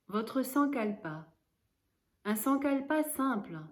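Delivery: normal talking voice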